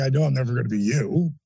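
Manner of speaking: sheepish repeat after a scolding. Retold or imitated. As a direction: mumble it back, embarrassed now. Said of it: "Now I feel kinda stupid".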